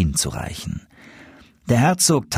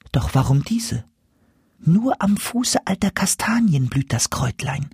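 secretive whispers; 7 semitones higher than usual. A narrator's reading. Dwarf and goose whisper about the herb. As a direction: keep it hushed, a secret. Secret talk between them.

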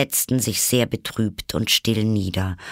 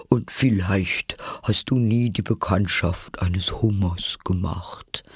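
breathy, tearful Mock Turtle; mournful, airy creature voice; tearful storytelling. A narrator's reading. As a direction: sigh it out through lingering tears. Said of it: "während Tränen ihre Wangen herabflossen".

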